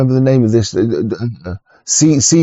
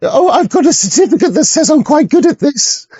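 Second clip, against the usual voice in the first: high, prissy mocking voice of a show-off; high sneering mimicry of a bragging muso; mocking boast. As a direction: send up a smug know-it-all in a high voice.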